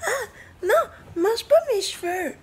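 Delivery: high startled squeal; squealing protest. Real, not acted. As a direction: yelp at the pet. L'animal mange ses cheveux. (cri aigu surpris)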